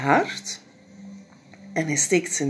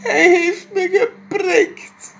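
slow, much higher, louder sobbing words; crying; 8 dB louder, slower than usual. Acted. Jip crying to mama about the sting. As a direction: cry it like a stung little boy. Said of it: "huilt Jip".

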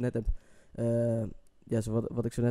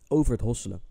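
ordinary delivery

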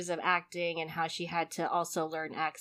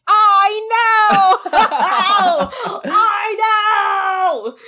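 much higher and louder, squealing with laughter; excited shriek; 13 semitones higher, 13 dB louder, much slower than usual. Retold or imitated. Retelling her reply to the Filipino gentleman, squealing excitedly. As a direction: shriek it with delight. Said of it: "And I'm like".

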